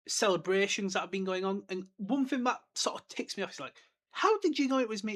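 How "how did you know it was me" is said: higher, louder indignant question; mock McGonagall. McGonagall asking Dumbledore how he recognised her, indignant. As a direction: voice her put-out surprise.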